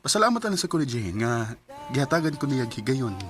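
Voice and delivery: usual speaking voice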